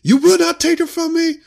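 squeaky hammed-up Obi-Wan; Obi-Wan impression. Obi-Wan answering Anakin, in a squeaky hammed-up voice. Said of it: "Obi-Wan's like".

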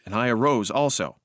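usual speaking voice

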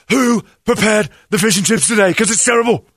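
high, loud outraged chef; angry chef shout. Retold or imitated. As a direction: bellow it like a furious kitchen boss. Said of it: "I wanted to go in the kitchen and go".

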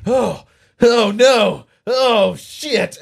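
loud, slow, high panic; panicked gasp. Pretend. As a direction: gasp it out like you were just shot. Shot man panicking.

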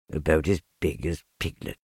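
low, downcast musing; sad self-talk. A narrator's reading. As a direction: repeat it sadly to yourself, low. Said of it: "he said to himself, sadly".

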